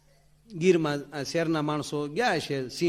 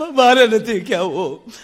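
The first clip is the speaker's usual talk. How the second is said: sulking refusal; 6 semitones higher, 7 dB louder, slower than usual. Acted.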